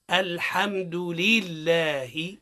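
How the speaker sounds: "الحمد لله" very slow and articulated; slow model recitation. Shown as a demonstration. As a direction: recite "الحمد لله" slowly for the class to repeat. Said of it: "نعاودوا مع بعضنا". (تلاوة متأنية للترديد)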